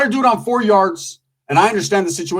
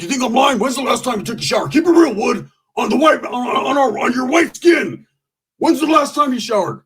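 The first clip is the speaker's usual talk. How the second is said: mock challenging shout; 7 semitones higher than usual. Homeboy teasing Shower Time about showers, shouting.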